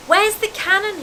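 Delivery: high-pitched dim-witted question; stupidly. A narrator's reading. Dudley waking with a jerk, speaking stupidly. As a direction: ask it high and dopey. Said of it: "he said stupidly".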